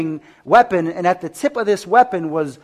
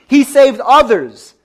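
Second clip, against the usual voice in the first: slow, higher, loud sneer; mocking; 6 semitones higher, 9 dB louder, much slower than usual. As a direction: drag it out with scorn. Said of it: "they mocked them, saying".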